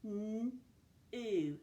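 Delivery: "N-oo" stretched, a long hummed n then a long oo; sounded out. Shown as a demonstration. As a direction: sound out "N-oo" slowly, sound by sound. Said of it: "go back to that initial sound".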